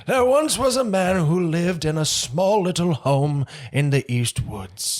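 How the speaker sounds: slow, high fairy-tale narration; bedtime story voice. Shown as a demonstration. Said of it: "read you a bedtime story".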